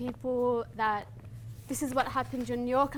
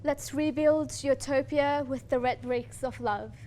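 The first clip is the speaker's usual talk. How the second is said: poem recitation; 2 semitones higher, 5 dB louder than usual. Acted. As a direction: declaim it like a lament for a lost homeland.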